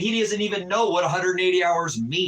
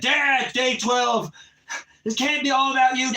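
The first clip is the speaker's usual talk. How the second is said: pleading shout; 6 semitones higher than usual. Retold or imitated. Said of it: "quoting his plea to his son".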